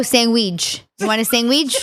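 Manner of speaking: "sandwich" said "sangwich" with a hard g, hammed up; comic New York Italian "sandwich" with an added g. Shown as a demonstration. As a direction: lean into the g in "sandwich", playfully. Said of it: "I'm being, like, funny a little bit".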